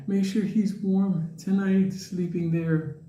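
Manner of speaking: imitating a fond old woman; doting old lady. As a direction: draw it out tenderly as an old lady. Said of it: "the lady's like".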